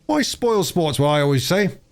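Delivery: rough country workman, easygoing; a lightly voiced old labourer.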